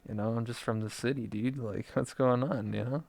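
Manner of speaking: soft, hesitant self-quote; meek reply. Retold or imitated. City visitor answering hillbillies, meek and quiet. Sheepish reply to hostile locals.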